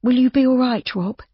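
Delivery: lightly voiced Judy, worried; worried young woman. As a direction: ask him with real concern.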